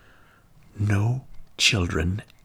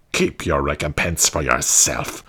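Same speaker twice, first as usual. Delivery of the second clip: proud; 6 semitones lower than usual. A narrator's reading. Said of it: "replied the piper proudly".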